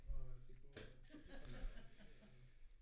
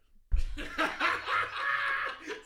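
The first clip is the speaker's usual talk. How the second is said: loud laugh; 9 dB louder than usual. Real, not acted. Bursting out laughing at joke.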